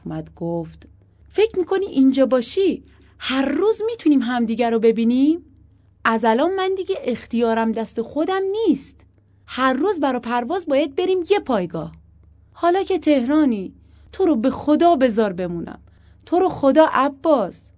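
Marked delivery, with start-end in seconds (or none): 1.3-11.9 loud, high-pitched reasoning; frustrated, forceful persuading; exasperated argument. A narrator's reading. Exasperated husband argues with wife. 12.5-17.7 high, loud begging; insistent plea; pleading. A narrator's reading. Wife begs to stay.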